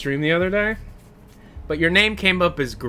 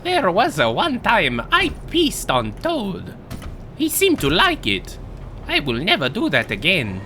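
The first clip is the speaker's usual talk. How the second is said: sing-song cartoon plumber telling a tale; bouncy, swooping mascot voice; Mario. Crude plumber confesses about Toad, sing-song cartoon voice. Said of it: "I am Mario!".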